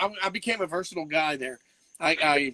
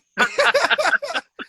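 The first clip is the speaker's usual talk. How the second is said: loud high laugh; 7 dB louder than usual. Real, not acted. Host laughs hard at his own joke, loud.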